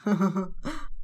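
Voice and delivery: low, soft laugh; brief laugh, kept low; short low chuckle. A narrator's reading. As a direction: give a quiet little chuckle. Saeed mocking her on phone.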